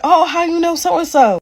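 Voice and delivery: high-pitched, put-on casual query; feigned innocent question. Woman probes server about her man, feigned innocence. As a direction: ask it high, playing innocent.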